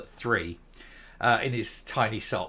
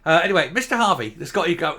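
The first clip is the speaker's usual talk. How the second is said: ordinary talk, nothing marked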